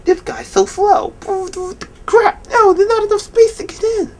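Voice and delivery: high whiny mock complaint; frustrated driver. Driver stuck behind him complaining, high whiny mock. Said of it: "And they're like".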